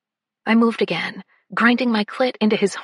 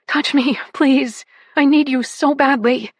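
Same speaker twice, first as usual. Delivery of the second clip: desperate begging; 4 semitones higher, 5 dB louder than usual. Acted.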